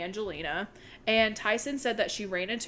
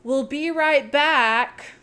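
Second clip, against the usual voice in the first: drawn-out, raised mock yell; retold yell; 4 semitones higher, 5 dB louder, much slower than usual. Roman calling out as he leaves, mock yell. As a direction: call it out long and loud. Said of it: "he yells".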